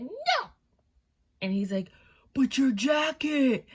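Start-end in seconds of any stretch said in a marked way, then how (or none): 0.0-0.5 very high squealed refusal; imitating a sketch girlfriend, shrill-high; girlfriend in a fight. Acted couple's fight in retold sketch. 2.3-3.6 loud, high, drawn-out mock yell; imitating a pleading sketch boyfriend; boyfriend calling after her.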